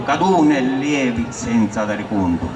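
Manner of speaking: plain reading voice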